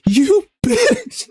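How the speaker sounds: high, laughing mock insult. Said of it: "I'm like".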